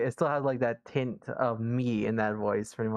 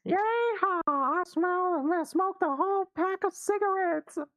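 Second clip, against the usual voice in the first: very high, twangy cartoon voice; goofy high voice; slower than usual. Mocking the jelly bean joke in a high goofy voice.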